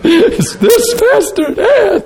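very high, very slow laughing woman's voice; tearful caller. Retold or imitated. Caller repeats her question, very high slow tearful voice. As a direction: squeak it out between tears.

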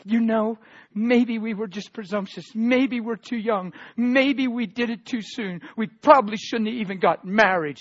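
blurted out. Retold or imitated. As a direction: blurt it out, lightly voicing the upset bride. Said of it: "she blurted out of her mouth".

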